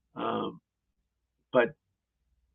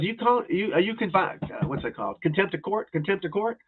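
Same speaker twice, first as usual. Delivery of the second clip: higher mock of the smug killer fumbling a word; mocking defendant; 6 semitones higher than usual. Defendant taunting the judge in court, high mocking voice. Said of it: "he goes".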